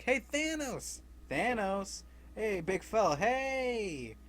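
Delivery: high yelled taunt at Thanos; calling out. Retold or imitated. Peter yelling high to lure Thanos, taunting call.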